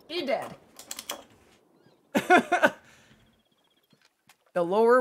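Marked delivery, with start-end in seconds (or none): 2.1-2.7 laugh. Real, not acted. Laughing during the hunt.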